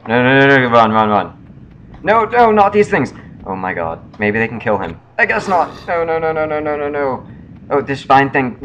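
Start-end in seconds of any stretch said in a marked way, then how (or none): 0.1-3.1 panicked yelling, no no run; frantic cries while fleeing a monster; being chased. Real, not acted. Being chased in game, screaming. 5.2-7.3 being chased. Real, not acted. Being chased, panicked string of no.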